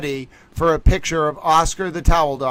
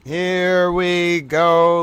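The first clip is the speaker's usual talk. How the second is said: drawn-out; much slower than usual. Real, not acted. Dramatic drawn-out round start.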